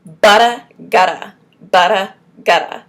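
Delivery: loud, firmly projected drill; forceful, separated words; strong from the diaphragm. Shown as a demonstration. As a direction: punch each word out from the diaphragm. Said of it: "say it with your diaphragm strong".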